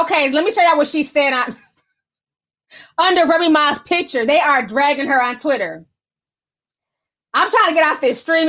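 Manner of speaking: usual speaking voice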